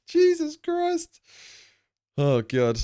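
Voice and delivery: slow, very high drawn-out gasp of disbelief; stunned exclamation. Real, not acted. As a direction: let it stretch out in amazement. Amazed at four-year follower.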